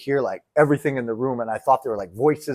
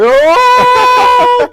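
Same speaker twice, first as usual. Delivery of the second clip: high, loud comic scream; mock terrified scream; 13 dB louder than usual. Telling friends about seeing the terrifying character, high hammed-up scream. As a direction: scream as if something jumped out.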